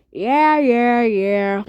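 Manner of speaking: ordinary talk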